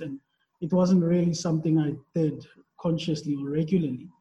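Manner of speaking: usual speaking voice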